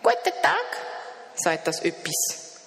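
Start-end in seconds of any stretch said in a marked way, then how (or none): 0.0-0.7 much higher, bright little creature voice; high creature greeting. A narrator's reading. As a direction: greet him like a cheerful odd creature. Das Etwas spricht. (hohe Stimme des Wesens)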